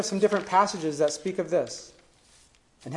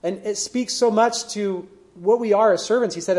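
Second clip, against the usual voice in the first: ordinary talk, nothing marked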